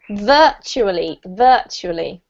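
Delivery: "virtually" slow and careful, VUR-chu-uh-lee; slow model word. Shown as a demonstration. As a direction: spell out "virtually" slowly in four beats. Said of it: "That's better".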